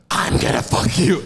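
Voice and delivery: sudden demonic outburst, acted out; possessed demon. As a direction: lash out as the demon inside her. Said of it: "Like a real demon?".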